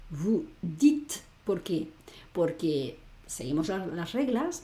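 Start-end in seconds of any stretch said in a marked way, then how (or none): 0.1-1.2 "vous dites" ending on a T, E unsounded; silent final E. Shown as a demonstration. Teacher shows the rule on "vous dites", final E silent. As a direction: say "dites" as one syllable, "deet". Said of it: "la E final de palabra".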